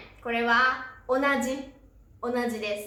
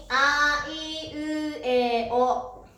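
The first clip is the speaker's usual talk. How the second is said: each vowel of あいうえお drawn out slowly; slow, even classroom recitation; slow vowel recital; 2 semitones higher, much slower than usual. Shown as a demonstration. Teacher recites the vowel row.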